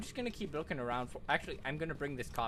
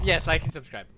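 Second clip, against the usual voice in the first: loud mock outro; 8 dB louder than usual. Mock vlog sign-off to viewers.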